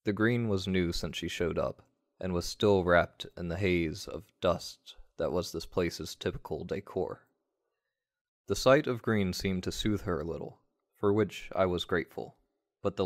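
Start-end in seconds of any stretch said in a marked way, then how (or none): none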